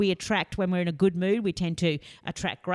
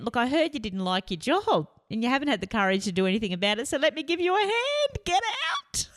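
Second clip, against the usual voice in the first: the universe losing patience, pitch rising all the way; the universe speaking; 7 semitones higher than usual. Pretend. Universe evicting the unhappy worker, rising to a high shoo. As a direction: voice climbing much higher to a high, dismissive get out. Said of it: "the universe was listening and said".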